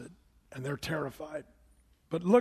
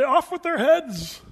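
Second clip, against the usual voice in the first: slow, loud, higher royal command; mock tyrant decree; 7 semitones higher, 7 dB louder, slower than usual. Pastor playing a vengeful Joseph, slow loud decree. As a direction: pronounce doom like a cruel king. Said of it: "I think I'd say".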